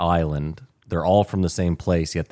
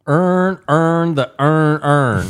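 much higher, louder, very slow Earn, earn the earn; mangled tongue twister; 9 semitones higher, 7 dB louder, much slower than usual. Retold or imitated. Baltimore guy struggling with Aaron ironed, high slow mock voice. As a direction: strain through it like a man failing the word. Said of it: "he's like".

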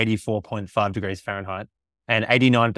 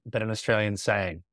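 normal talking voice

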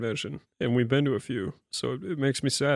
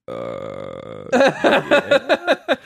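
baffled uh yeah; 14 semitones lower, 5 dB louder than usual. Retold or imitated. Recalling a waitress asking if he knew Flash Gits, confused. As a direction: stall confused, then blurt yes. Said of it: "I was like".

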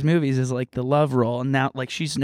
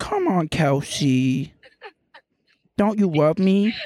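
put-on baby talk; 3 semitones higher, much slower than usual. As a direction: whine sweetly for affection. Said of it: "they're not baby talkers".

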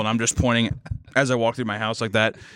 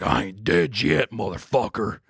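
slow, put-on horror villain line; mock killer voice; 3 semitones lower, slower than usual. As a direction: deliver like a slasher getting back up. Imagined unkillable killer.

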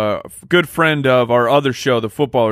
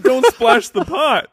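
higher, slow mock Russian accent; 9 semitones higher, slower than usual. Co-host questions the chosen line, mock Russian accent. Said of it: "The whispering Russian".

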